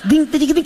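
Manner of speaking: mouthed banjo twang; banjo imitation. Shown as a demonstration. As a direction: pick out a banjo riff with the mouth. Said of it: "let me be your banjo".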